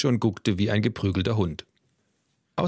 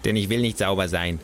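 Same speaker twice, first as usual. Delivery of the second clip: grumbling refusal. Acted. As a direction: grumble it stubbornly. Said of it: "Das Neinhorn grummelte".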